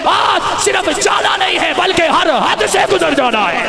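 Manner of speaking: shouted, high, at full force; fiery preaching. Acted. Preacher roaring to the rally crowd, at full force. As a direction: thunder it out to the crowd.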